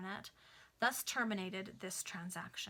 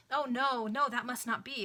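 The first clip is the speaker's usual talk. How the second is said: loud, higher pleading no, no; frantic exclaiming; 4 semitones higher, 8 dB louder than usual. Acted. Stevens begging Greenwood not to ring the bell, loud frantic plea. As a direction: beg him to stop, loud and urgent. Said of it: "exclaimed Stevens".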